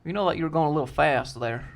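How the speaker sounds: Southern state trooper at a traffic stop; Georgia State Patrol accent. Shown as a demonstration. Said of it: "That's the Georgia State Patrol accent".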